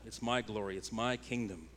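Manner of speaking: normal talking voice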